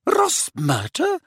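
high, slow disbelief; incredulous. A narrator's reading. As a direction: say it in shocked disbelief. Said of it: "said Professor McGonagall incredulously".

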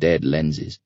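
ordinary delivery, nothing marked

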